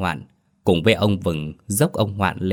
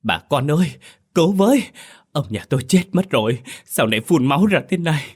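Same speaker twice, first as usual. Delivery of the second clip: panicked shriek, much higher and louder, fast; 8 semitones higher, 4 dB louder, faster than usual. A narrator's reading. Wife screaming as her husband spits blood. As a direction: scream for help in terror. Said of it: "hét lên một tiếng".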